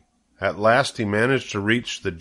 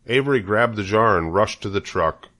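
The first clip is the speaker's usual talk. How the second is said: ordinary delivery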